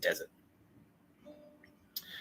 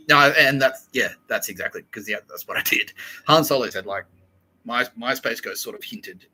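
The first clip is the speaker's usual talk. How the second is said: ordinary talk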